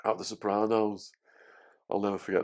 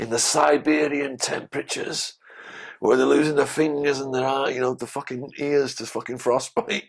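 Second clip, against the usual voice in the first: a mock rant, pitched higher; raised-pitch impression of a scornful old lag; imitating Two Tonys; 6 semitones higher than usual. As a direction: do the old mafia cellmate scoffing at whiners. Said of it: "he'd be like".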